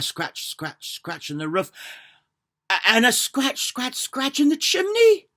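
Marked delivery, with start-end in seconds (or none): 2.7-5.3 rising higher and louder to the chimney; building scare. A narrator's reading. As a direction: build it up scratch by scratch.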